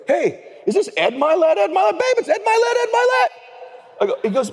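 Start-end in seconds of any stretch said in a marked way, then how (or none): 0.1-3.3 starstruck, calling out to his wife; excited disbelief. Retold or imitated. Comedian calls his wife over, climbing in excited disbelief. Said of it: "message back, he goes".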